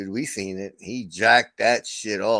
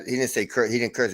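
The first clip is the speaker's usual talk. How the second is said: usual speaking voice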